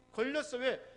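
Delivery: ordinary delivery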